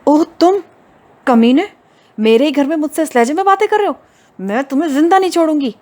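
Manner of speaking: high, loud and fast furious shouting; enraged threat. A narrator's reading. Hoor threatens Usama in her own house, high fast furious shouting. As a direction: spit the threat at him in fury.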